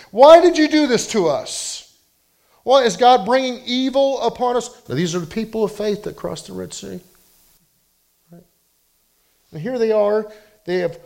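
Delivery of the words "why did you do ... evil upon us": high, loud desperate wail; anguished outcry. Acted. Preacher voicing the Israelites' loud despairing cry at Moses. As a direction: cry out as if abandoned by God.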